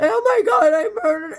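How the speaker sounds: imitating a hysterical confession, very high-pitched; loud, tearful mock wail; crying and shaking. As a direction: mimic someone breaking down in horror. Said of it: "he's crying and he's shaking".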